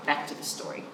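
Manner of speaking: whispered aside. Real, not acted. Reader whispering to listeners, back to the story. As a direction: whisper it softly.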